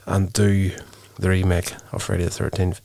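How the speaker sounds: normal talking voice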